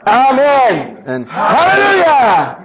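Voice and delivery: huge high shout breaking into a laugh; shouted amen and hallelujah. Acted. Preacher finishing the practice shout, laughing as he yells. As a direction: let it ring out. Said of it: "let's shout the hallelujahs".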